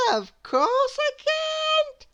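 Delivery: very high, slow sing-song voice; cheerfully refuse, very high; contrary Miss Contrary. Acted. Miss Contrary answers Mister Small, very high sing-song. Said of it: "she said".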